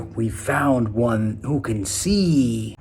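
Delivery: slow, eerie voice of an unseen watcher, lightly done; slowly, like a sinister overseer spotting a rebel; ominous mock voice. Mock voice of the controllers.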